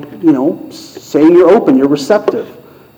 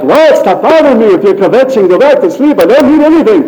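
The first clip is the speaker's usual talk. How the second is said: put-on high, loud, irritated old man; grumpy old Eli; 6 semitones higher, 4 dB louder than usual. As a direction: snap at the boy for waking you. Eli voiced again, annoyed.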